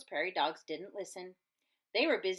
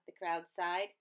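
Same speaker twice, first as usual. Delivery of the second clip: ordinary delivery, nothing marked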